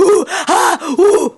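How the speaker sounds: loud, high mock hyperventilating hoots; hammed-up heaving breaths; faked panic attack. Shown as a demonstration. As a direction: puff out high, exaggerated panic gasps. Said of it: "making it up that it's like".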